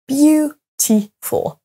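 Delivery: "Beautiful" very slowly as BEAU... ti... ful, high first syllable. Shown as a demonstration. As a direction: break "Beautiful" into three, strongest on BEAU. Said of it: "accentués sur la première syllabe".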